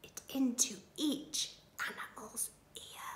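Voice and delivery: soft, breath-only storytelling whisper. A narrator's reading. Ladybird passing her idea to each animal, whispering. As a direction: drop into a secretive whisper. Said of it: "and she whispered".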